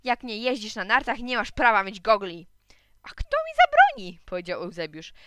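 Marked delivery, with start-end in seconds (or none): 0.0-2.5 loud scolding retort; loud, indignant objection; shouting. A narrator's reading. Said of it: "krzyknął Gottfried". 3.0-4.2 very high, loud squawk; high-pitched defiant yell; defiant child. Acted. Child defiantly retorts in quarrel.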